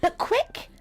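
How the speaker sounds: sudden high alarm; urgent high cry. Acted. Warning that Mum is coming, high and urgent.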